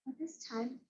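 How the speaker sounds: soft gasping breaths; dragon gasping. Acted. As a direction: gasp as if the fire is gone.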